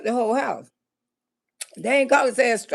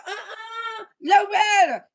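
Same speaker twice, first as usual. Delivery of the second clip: put-on pleading boyfriend; 6 semitones higher, slower than usual. Boyfriend's excuse voiced.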